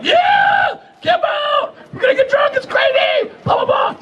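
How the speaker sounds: mock your own young excitement, yelling; excited. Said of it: "and I'm all excited".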